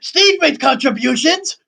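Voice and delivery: high, slow indignant protest; squeaky outraged insistence. Acted. Defending Steve loudly.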